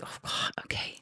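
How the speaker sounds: soft unvoiced worry; hushed dismay; whispered alarm. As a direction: breathe it out, alarmed.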